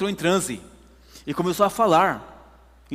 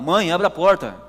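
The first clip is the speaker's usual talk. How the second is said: calling out; 2 semitones higher, 6 dB louder than usual. Retold or imitated. Son keeps calling while knocking continues.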